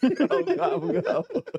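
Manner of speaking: very high, laughing gobble; turkey gobble. Retold or imitated. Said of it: "this fool's fucking turkey just going".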